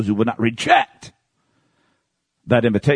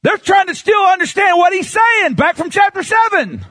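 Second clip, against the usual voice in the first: high, forceful pulpit cry; fiery preaching; 4 dB louder than usual. Real, not acted. Preacher hammering Jesus's words to the congregation, fiery. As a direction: preach it out, pitched high and driven.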